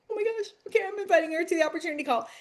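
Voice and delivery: retelling an excited rush of joy; giddy excitement. Retold or imitated. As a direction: rush it out like you can't contain yourself. Excited moment of inviting friend.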